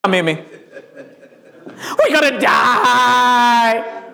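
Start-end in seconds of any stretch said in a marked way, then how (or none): 2.0-4.0 high, long-drawn wail of doom; hammed-up hysterical shriek; panicked scream. Shown as a demonstration. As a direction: scream it in terror, stretching the last word. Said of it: "what I just screamed".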